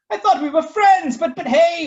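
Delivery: very high, loud laughing stammer; stuttering. Acted. Waffle stammers at the frightened kids, very high. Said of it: "Waffle stuttered".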